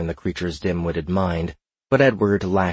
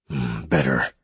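cold; 6 semitones lower than usual. A narrator's reading. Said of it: "the Spy Master said coldly".

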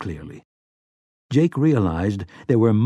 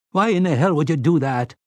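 higher wounded whine; injured, whiny; 5 semitones higher than usual. A narrator's reading. Slightman complaining to the robot, injured and whiny. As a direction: whine it like you've been wronged. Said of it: "Slightman sounded injured, almost whiny".

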